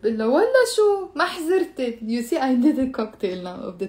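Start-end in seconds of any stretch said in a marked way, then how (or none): 0.3-2.0 high, teasing ولا شو then a falling ما حزرت; mock the request with a cheeky no; sarcastic refusal. Teacher acts refusing Nabila's chocolate request, cheeky. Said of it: "ونبيلة بتقلي".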